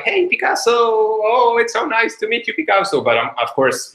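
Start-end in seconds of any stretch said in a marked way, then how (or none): 0.0-2.5 high, excited fan voice; gushing woman. Retold or imitated. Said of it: "and she's like". 2.5-3.0 Picasso said in English, high gushing woman's voice; plain English Picasso in the fan's voice; English version. Retold or imitated. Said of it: "imagine it's in French".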